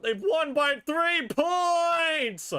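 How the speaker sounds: high, loud, drawn-out wail; outraged cry. Real, not acted. Fan seeing a three-point win, loud outraged wail. Outraged at the narrow winning margin.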